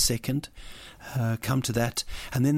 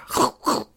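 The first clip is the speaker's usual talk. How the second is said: high laugh; 14 semitones higher, 5 dB louder than usual. Real, not acted. Host laughing at his own joke, loud and high.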